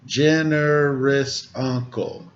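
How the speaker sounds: slow, measured poem line; haiku recital. Shown as a demonstration. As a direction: pause on each word like a haiku. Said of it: "speaking in what's called a haiku".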